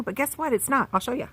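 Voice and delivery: ordinary delivery, nothing marked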